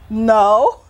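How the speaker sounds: sudden loud cry of disbelief, pitch jumping up; shouted no. Retold or imitated. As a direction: blurt it out as a shout of denial. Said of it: "I remember it was shout".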